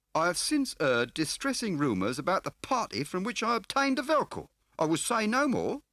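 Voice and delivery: smarmy dealer playing grand; oily fat car dealer. A narrator's reading. Scar's voice held.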